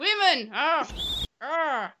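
high-pitched fake Irish exclamation; hammed-up stage-Irish voice; mock Irish accent. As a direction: put on a silly Irish lilt. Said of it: "and they say girls".

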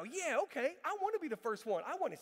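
suddenly all in, begging to go first; eager kid. Retold or imitated. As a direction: kid brother talked into it, now keen. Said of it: "and so he starts now".

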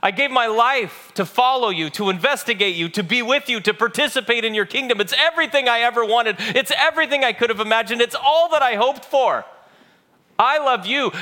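high, impassioned protest of love, building; hurt pleading. Acted. Peter to Jesus after the third question, hurt and impassioned. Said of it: "He's almost hurt".

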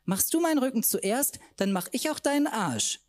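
high eager woman's voice; lightly voiced tipsy friend; high playful offer; eager sister.

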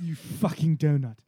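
loud, drawn-out chef insult; furious chef. Pretend. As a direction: spit the insult like a raging chef. Gordon Ramsay impression.

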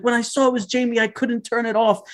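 put-on very high, eager older woman; excited mom. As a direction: ask all hopeful and thrilled. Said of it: "And she's like".

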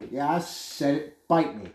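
usual speaking voice

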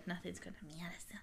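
fast unvoiced whisper. Real, not acted. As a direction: hush it under your breath. Whispered aside.

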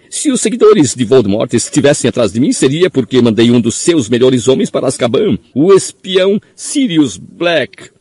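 high, pleading, nervous little man; frightened squeaky man. A narrator's reading. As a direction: squeak it out in fear. Pettigrew pleads, voiced high.

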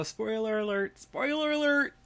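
high, climbing alert call; mock warning alarm. Host warns listeners of spoilers, mock alarm. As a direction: sound a playful alarm, pitching the repeat way up.

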